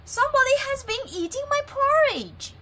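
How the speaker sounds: high, loud and quick mama bear; a brisk indignant mother bear; Mother Bear's medium voice. Acted. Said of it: "said in her medium-sized voice".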